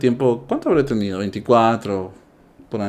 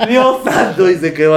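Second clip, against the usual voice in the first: spoken through laughter, high; high laughing exclamation; 6 semitones higher, 3 dB louder than usual. Real, not acted. Still laughing after the remark.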